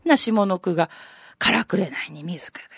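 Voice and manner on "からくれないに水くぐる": breathy whisper of the line; hushed poem recital; whispered verse. Shown as a demonstration.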